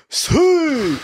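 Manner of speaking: high, held horn-like whoop; imitated yell. Recalling what was heard in the first race, high mock yell. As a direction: mimic a yell in a high pitch. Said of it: "you yelled out".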